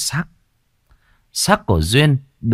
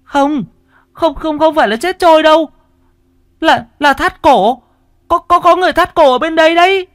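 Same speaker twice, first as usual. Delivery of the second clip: high, loud, stammering panic; breathless panic; 7 dB louder than usual. Acted. Dần runs to tell villagers of a hanging, high breathless panic. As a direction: gasp out terrible news in a high voice. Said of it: "nói trong hơi thở hổn hển".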